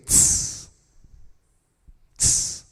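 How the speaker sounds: sharp unvoiced tss through the teeth; beatbox hi-hat tss; hi-hat hiss. Shown as a demonstration. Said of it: "You play it like this".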